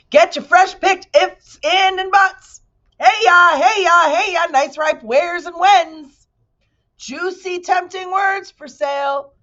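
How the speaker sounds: high, slow market hawker bellow; booming street vendor; loud merchant call. Acted. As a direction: cry out your wares to the crowd. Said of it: "merchants' voices loudly advertising".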